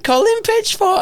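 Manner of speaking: very high, hammed-up name call; playful mockery. MC mocking the killer's name, playful and high. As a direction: relish the silly name in a high voice. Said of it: "in one of my little playful M C roles".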